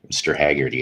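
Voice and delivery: usual speaking voice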